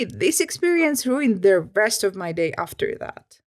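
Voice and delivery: ordinary delivery, nothing marked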